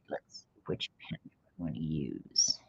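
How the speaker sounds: low whisper to herself. Real, not acted. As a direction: whisper it, thinking aloud. Choosing a pen, to herself.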